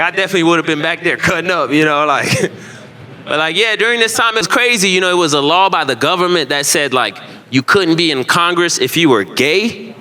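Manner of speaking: ordinary talk, nothing marked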